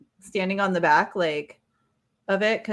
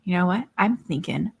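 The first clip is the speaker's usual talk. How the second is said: usual speaking voice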